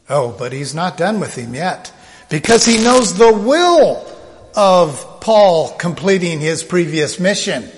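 ordinary talk

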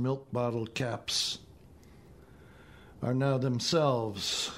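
measured, unhurried poetry reading with long pauses; poem reading. Acted. Poet reading his elegy to a radio audience, measured and unhurried. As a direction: let each image settle before the next line.

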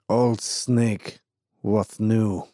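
put-on gruff Eastern European accent, clipped words. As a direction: bark it like a suspicious Eastern European miner. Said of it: "with an Eastern European accent".

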